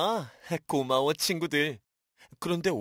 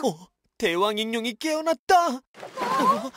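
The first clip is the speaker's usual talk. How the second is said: high, loud panicked cry; alarmed; 8 semitones higher, 5 dB louder than usual. Acted. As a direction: panic as it wakes. Villain wakes up.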